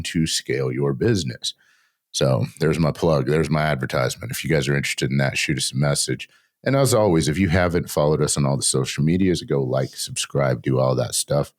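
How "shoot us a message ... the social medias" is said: ordinary delivery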